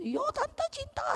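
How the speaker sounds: put-on a child calling out an eager reply to the teacher; child's answer. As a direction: play an eager little kid.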